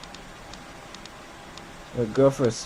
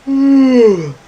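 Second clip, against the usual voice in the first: long loud sleepy yawn; big yawn; 12 semitones higher, 12 dB louder than usual. Real, not acted. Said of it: "It makes me yawn a lot".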